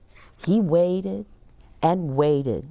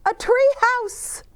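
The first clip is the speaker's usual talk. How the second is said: excited reveal; 3 dB louder than usual. A narrator's reading.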